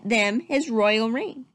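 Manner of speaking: ordinary delivery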